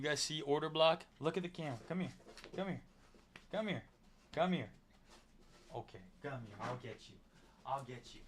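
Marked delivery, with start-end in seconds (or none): 1.2-8.2 coaxing the dog. Real, not acted. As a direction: sweet-talk the dog, repeating the call.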